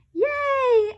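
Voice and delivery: high, loud yay; cheering shout. A narrator's reading. The class cheering about toilet seats, high and loud. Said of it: "everybody shouted".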